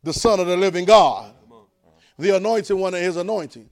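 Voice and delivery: loud, ringing sermon delivery; full-force preaching. Acted. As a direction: preach it out to the congregation.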